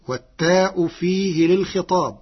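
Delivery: normal talking voice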